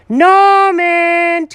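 high, loud call up the stairs; cat calling the builder upstairs, loud and high. Acted. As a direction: yell for him from below.

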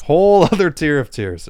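delighted echo of the pun; laughing repeat. Real, not acted. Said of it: "repeating the pun".

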